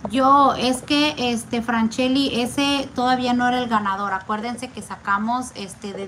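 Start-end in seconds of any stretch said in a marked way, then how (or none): none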